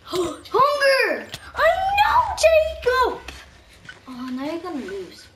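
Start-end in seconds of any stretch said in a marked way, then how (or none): 0.0-3.2 high, loud game shout; excited yelling. Real, not acted. Player yells to Jacob mid-game, shouting. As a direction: yell it out at a high pitch.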